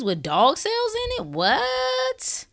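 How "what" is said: high squeaky disbelieving what; incredulous what. Real, not acted. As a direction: act stunned and baffled. Incredulous at vaccine hypocrisy.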